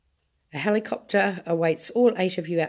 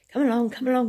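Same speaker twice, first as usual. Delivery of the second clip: high, louder call; 7 semitones higher, 5 dB louder, faster than usual. A narrator's reading. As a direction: call them to hurry. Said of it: "he called out".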